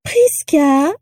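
mimicking a shrill little voice; high, drawn-out mock call; Prisca Malfoy. A narrator's reading. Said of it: "en imitant la voix aigrelette de Prisca".

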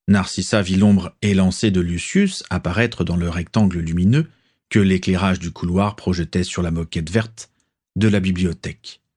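ordinary delivery, nothing marked

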